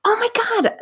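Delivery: high gasping oh my God; sudden delighted shock; surprised. Real, not acted. Gasps at surprise news.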